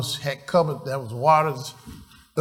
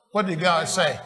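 usual speaking voice

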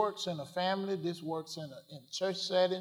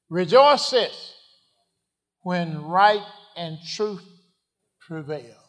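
full-force preaching; 11 dB louder, much slower than usual. Acted. Preacher crying out rejoicing to his congregation. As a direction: lift the church with the word.

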